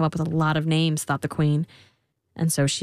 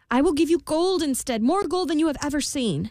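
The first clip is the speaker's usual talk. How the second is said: higher, earnest queen voice; pleading queen; 9 semitones higher than usual. A narrator's reading. Queen bargaining with the imp, higher pleading. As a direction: offer it like a worried mother. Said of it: "She said".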